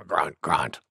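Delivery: very low gruntlike words; boar's grunting. A narrator's reading. Wild Boar after the big sneeze, low grunting.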